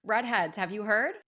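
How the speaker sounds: upbeat sponsor pitch to listeners; ad read. Acted. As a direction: pitch the brand like a host ad. Podcast host starts ad read.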